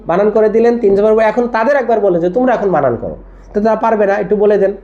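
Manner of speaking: ordinary talk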